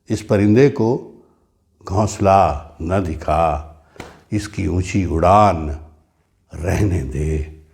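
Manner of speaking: slow, measured verse with long pauses; poetry recitation. Acted. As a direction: recite line by line, letting each ending fall. Recita una estrofa de poesía.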